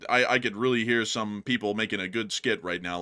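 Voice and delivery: normal talking voice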